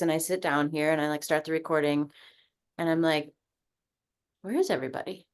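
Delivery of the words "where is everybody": ordinary delivery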